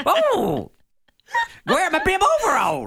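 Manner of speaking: high-pitched prospector voice; a hint of a hillbilly prospector; old prospector. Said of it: "Old prospector's gonna have to".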